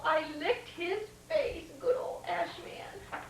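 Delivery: ordinary delivery, nothing marked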